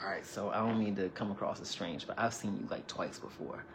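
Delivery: a nervous man confessing to her over lunch; soft and vulnerable. Retold or imitated. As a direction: confess it shyly, barely above a hush. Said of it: "He was very vulnerable".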